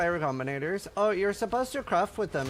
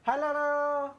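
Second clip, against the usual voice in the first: high sing-song hello to a pet; pet greeting; 10 semitones higher than usual. Real, not acted. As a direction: coo hello at the animal, much higher.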